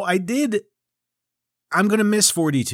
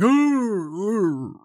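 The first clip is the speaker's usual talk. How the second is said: high, hooting mock yawn sound; 7 semitones higher than usual. Shown as a demonstration. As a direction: make a silly yawning noise. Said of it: "that's the sound you make when you yawn".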